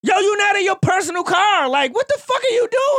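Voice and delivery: furious rant at a company driver; yelling at driver. Retold or imitated. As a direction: scold the reckless driver hard.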